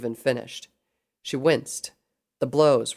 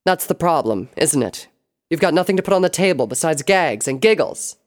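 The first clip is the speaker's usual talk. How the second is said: raised, forceful comeback; scornful retort; 4 semitones higher, 6 dB louder than usual. A narrator's reading. Sable belittling Pinkie mid-fight, scornful retort. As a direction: drive the retort home loudly. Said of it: "Sable retorted".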